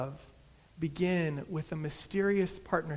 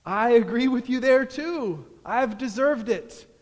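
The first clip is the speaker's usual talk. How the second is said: loud, high declaration; emphatic cry; 5 semitones higher, 10 dB louder than usual. A narrator's reading. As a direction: proclaim it at full voice. Narrator's character crying out his agreement.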